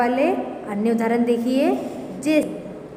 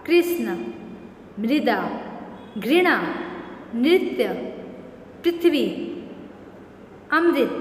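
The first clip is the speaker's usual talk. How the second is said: classroom word drill. Shown as a demonstration. Teacher drills list of ऋ words.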